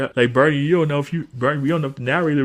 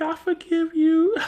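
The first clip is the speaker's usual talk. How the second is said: imitated child. Retold child forgiving uncle, high voice.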